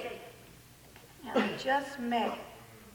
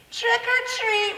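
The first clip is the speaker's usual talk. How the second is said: put-on girl trick-or-treating; 10 semitones higher, 4 dB louder, slower than usual.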